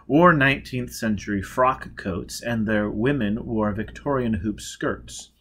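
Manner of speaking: ordinary delivery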